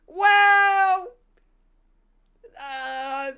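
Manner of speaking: high, loud drawn-out lady voice; mimicking Uber lady. Telling tourists about South Beach, in a high mock lady voice. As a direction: stretch the well like a gossiping driver. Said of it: "she goes".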